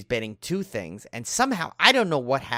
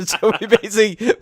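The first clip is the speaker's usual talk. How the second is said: laughing; 9 semitones higher, 11 dB louder than usual. Real, not acted. Laughing at own Jurassic joke.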